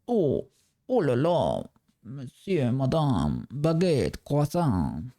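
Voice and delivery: mock French chef rattling off clichés; hammed-up French accent, oh la la; fake French accent.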